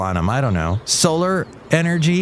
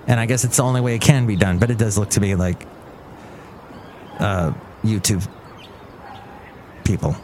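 usual speaking voice

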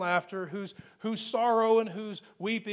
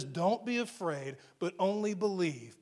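steady the father quietly; Jesus to the father, tender and hushed; gentle reassurance; 3 semitones lower, 5 dB quieter than usual. Acted. Jesus looks into father's eyes.

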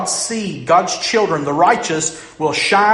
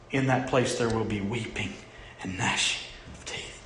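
softer, dropping to a whisper on gnashing of teeth; hushed verse; 5 semitones lower, 8 dB quieter than usual. Acted. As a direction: let the verse sink to a grave whisper. Preacher repeats verse, voice sinking.

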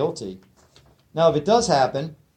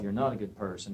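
low, soft voice of the mind; hushed, low inner critic; accusing inner voice; 5 semitones lower, 10 dB quieter, faster than usual. Acted. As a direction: quietly act out self-accusation. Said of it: "then the mind goes off".

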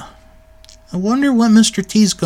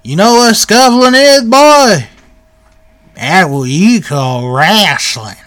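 Uncle Elmer; 2 semitones higher, 4 dB louder than usual. Retold or imitated. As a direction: imitate a hillbilly explaining his word, drawn out at the end. Voices Uncle Elmer explaining scuffling.

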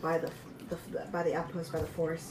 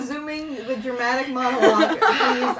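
laughing; 4 semitones higher, 7 dB louder than usual. Real, not acted. GM laughing at table.

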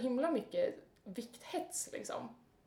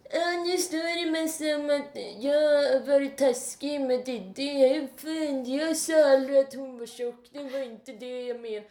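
a whiny, put-upon blogger voice, louder; 4 semitones higher, 7 dB louder than usual. Retold or imitated. Imitating Oliver's blog post with a loud whiny voice. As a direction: whine the excuse like a sulking boy. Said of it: "en gnällig röst".

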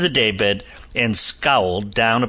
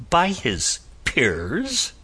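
taken aback; 7 semitones higher, much slower than usual. A narrator's reading. Captain echoes in surprise.

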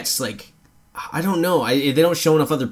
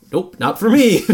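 higher-pitched breezy brush-off, half laughing; mock refusal; 9 semitones higher, 4 dB louder than usual. Quoting a movie character's blunt opt-out, high mock refusal. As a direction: toss it off high, amused.

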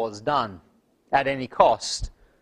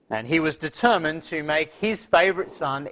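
usual speaking voice

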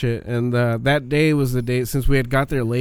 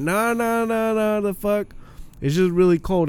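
high squeaky denial, pitched way up; high mock voice; 8 semitones higher than usual. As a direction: brush it off in a high voice. Guy brushing off, high voice.

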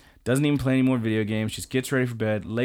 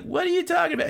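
high mock bewildered voice; high baffled teacher; puzzled teacher; much faster than usual. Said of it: "He's like".

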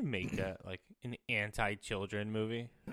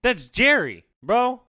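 mock outrage; 9 semitones higher, 12 dB louder, slower than usual. Real, not acted. Protesting the mouse killing.

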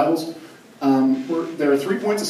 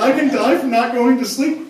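put-on shocked kid; 8 semitones higher, 5 dB louder than usual. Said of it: "You're like".

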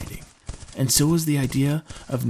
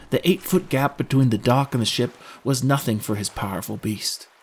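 ordinary delivery

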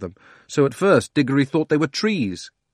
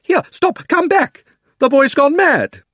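much higher and louder, shouting after them; frantic calling out; 9 dB louder, slower than usual. Acted. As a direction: call after runaway children in panic. Uncle Andrew calls after children.

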